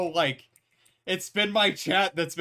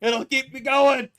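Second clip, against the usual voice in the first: excited; 7 semitones higher, 6 dB louder than usual. Real, not acted. Hyped streamer shouting with laughter.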